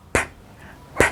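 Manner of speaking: a P puffed out with air, no voice; voiceless P. Shown as a demonstration. Teacher showing the English P sound, voiceless puff. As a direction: push out only air for the P. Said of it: "un sonido sin voz".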